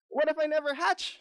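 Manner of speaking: very high, panicky mock shout; shouting egg. A narrator's reading. Egg frets about hatching, very high mock shout. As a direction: worry aloud in a very high pitch. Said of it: "shouts the egg".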